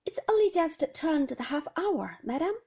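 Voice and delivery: high, loud chirpy maid voice; parlour-maid answering the old lady in a special cheerful voice; bright and cheerful. Acted. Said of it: "in a special voice, bright and cheerful".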